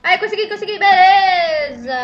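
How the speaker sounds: loud, drawn-out cry of relief; triumphant shout. Real, not acted. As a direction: celebrate the win loudly. Succeeding after struggle.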